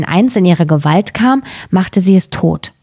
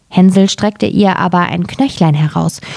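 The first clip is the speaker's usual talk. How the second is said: ordinary delivery, nothing marked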